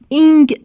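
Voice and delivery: اینگ with a hard, fully sounded G; over-pronounced اینگ, the final G released. Shown as a demonstration. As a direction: hit اینگ loudly, closing on a firm G. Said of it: "تلفظ نمیشه".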